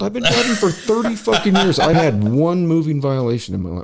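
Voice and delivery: high-pitched, indignant rant; pissed off. Real, not acted. Driver griping about a costly ticket, pissed off and high-pitched. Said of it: "I was pissed about this one".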